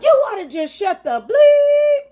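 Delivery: very high, loud, slow mocking shout; mock yell. Coworker cursing her out, high slow mock yell. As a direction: drag out a furious caricature yell. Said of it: "he was yelling".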